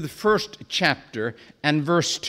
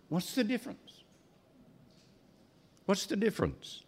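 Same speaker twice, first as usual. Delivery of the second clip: hushed question; 12 dB quieter, much faster than usual. Real, not acted. Rhetorical question to the congregation.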